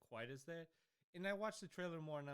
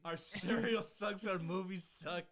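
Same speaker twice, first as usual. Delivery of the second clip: loud mock complaint; 3 semitones higher, 7 dB louder, slower than usual.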